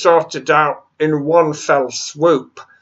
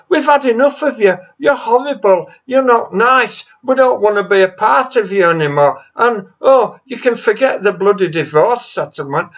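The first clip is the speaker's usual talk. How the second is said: higher, fast, heated tirade; defiant telling-off; 4 semitones higher, faster than usual. Real, not acted. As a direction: give the EU a piece of your mind.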